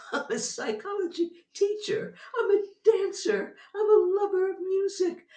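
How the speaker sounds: high and loud, half laughing, half crying; excited exclaiming. Real, not acted. Telling the audience who she is, high and emotional. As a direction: fling out each line with giddy emphasis.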